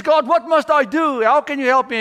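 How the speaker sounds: high, loud questions thrown upward; shouted plea. Retold or imitated. Hanging man asks God what to do, shouting. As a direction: call out urgently for instructions.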